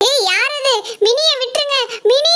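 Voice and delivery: high, crying shout at the unseen captor; alarmed shout. Acted. Reni shouts at whoever is dragging Mini away, alarmed. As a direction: yell at them to let Mini go.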